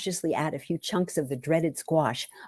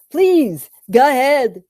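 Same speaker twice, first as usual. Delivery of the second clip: higher and louder, generous host; hearty invitation; 7 semitones higher, 10 dB louder, slower than usual. Acted. Marty urging Lisa to eat, louder and higher. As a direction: urge her on like a proud host.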